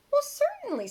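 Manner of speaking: very high, loud cheerful kangaroo. Acted. Kangaroo happily agrees to share bricks, very high. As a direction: chirp it out like a kind kangaroo. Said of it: "said the kangaroo".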